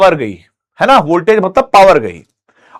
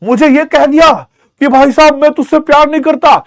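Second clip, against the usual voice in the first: mild mock anger; 6 semitones higher than usual. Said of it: "electron को गुस्सा आ गया".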